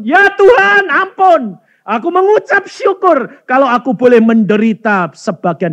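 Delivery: high, loud desperate plea; imitating mother's fervent repentance, pitched high; begging forgiveness. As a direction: cry out for mercy. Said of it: "Langsung ibu saya minta ampun".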